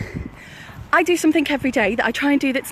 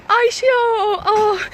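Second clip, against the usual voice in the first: high voice breaking with real laughter; laughing hard; 9 semitones higher, much slower than usual. Real, not acted. Tickled by the man's proposal, laughing through words. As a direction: recover from a laughing fit, still high.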